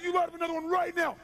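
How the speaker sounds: high, loud furious yell; unhinged bullying command; screamed order. Retold or imitated. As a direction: shout them down like a madman. Screamed order in retold standoff.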